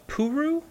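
Puru said slowly as poo-roo, pitched high; pronunciation demo. Shown as a demonstration. Host showing co-host how the odd name is pronounced, Puru drawn out as poo-roo. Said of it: "but it's pronounced".

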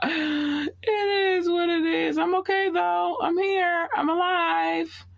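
high, slow hammed-up sob; pretend-weepy reassurance that she survived; mock crying. Mock sobbing after a choking fit.

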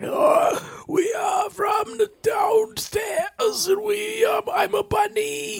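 high eerie strained doppelganger voice; Lupita creepy voice. Retold or imitated. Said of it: "my bunny could have Lupita Nyong'o's creepy voice".

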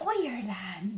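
put-on terrible Irish accent. Following partner's vowel advice, terrible Irish accent. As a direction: try a bad Irish accent. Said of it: "That's also a terrible Irish accent".

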